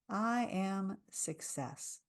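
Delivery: soft, slow, settled affirmation. Shown as a demonstration. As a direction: let each word land slowly. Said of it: "repeat after me and listen".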